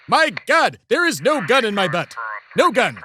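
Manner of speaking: high-pitched, exasperated yelp; indignant protest. Acted. Host to searching agent about a gun, indignant protest.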